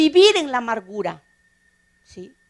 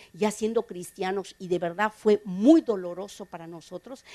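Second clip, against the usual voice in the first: usual speaking voice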